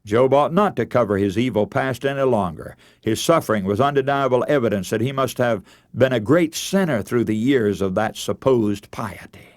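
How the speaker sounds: ordinary delivery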